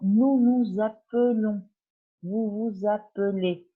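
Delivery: slow clear "Nous nous appelons", "Vous vous appelez" with single L; teacher sounding out "appelons" for students; slow French demo. Shown as a demonstration. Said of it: "cuando nosotros decimos".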